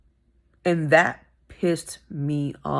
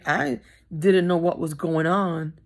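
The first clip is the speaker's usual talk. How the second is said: usual speaking voice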